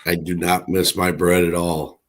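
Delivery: usual speaking voice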